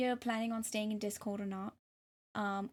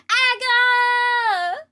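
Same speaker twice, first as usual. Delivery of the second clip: sudden very high, much louder yelp; 13 semitones higher, 15 dB louder than usual. Real, not acted. Streamer yelping to chat, high sudden squeal. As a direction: burst out with a sharp squeal.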